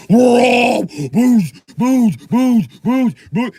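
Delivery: slow, loud drawn-out horn chant; loud stretched horn imitation; mock boat horn. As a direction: blare it like a horn. Imitating his boat's horn.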